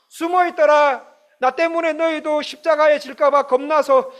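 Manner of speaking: a preacher voicing a hurt, scolding Jesus; rebuking. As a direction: scold them loud and high, disappointed. Preacher voices rebuking Jesus hypothetically.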